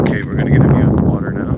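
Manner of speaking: breathless while hiking fast; out of breath. Real, not acted. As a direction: talk between breaths on a climb. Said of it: "And I'm gonna be out of breath".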